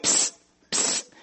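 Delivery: soft hissed psst to get attention; whispered psst. Retold or imitated. Deputy hiding as mannequin signals the sheriff, hissed psst. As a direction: hiss it quietly like a hidden deputy. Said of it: "and Barney said".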